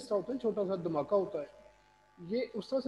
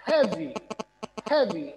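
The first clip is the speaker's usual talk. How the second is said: Heavy said loud and heavy; loud heavy stress; 3 semitones higher, 7 dB louder, slower than usual. Shown as a demonstration. Teacher demonstrating to class: heavy loud and heavy. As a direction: punch heavy out loud, falling. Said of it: "louder हमने करना है इसको".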